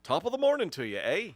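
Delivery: loud, high-pitched mock Irish greeting; put-on Irish accent. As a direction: belt out a hammed-up Irish accent. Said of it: "how about I love your accent".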